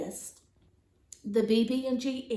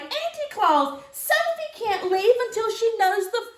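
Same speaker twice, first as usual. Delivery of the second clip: high loud fussy elf voice; Mister Pudding pleading; 8 semitones higher, 5 dB louder than usual. Acted. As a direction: insist anxiously, pitched up. Mister Pudding keeps fussing.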